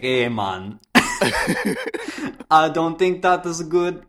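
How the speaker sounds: laughing bad Jamaican accent. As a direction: try a Jamaican accent, giggling. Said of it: "how to do a Jamaican accent".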